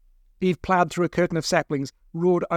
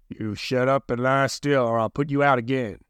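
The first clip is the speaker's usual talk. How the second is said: hissing whisper; 2 semitones lower than usual. A narrator's reading. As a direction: hiss the threat close to the ear. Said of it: "he hissed in my ear".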